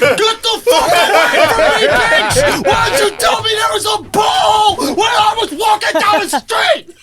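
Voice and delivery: high-pitched yelling rant; acted outburst. Hurt man blaming girlfriend for the pole, hammed-up yelling. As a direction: blow up at her in a hammed-up shout. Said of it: "'Cause I didn't wanna say".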